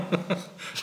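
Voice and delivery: a quick breathless chuckle; breathy laugh. Real, not acted. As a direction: laugh along at the silly joke. Laughing at joke.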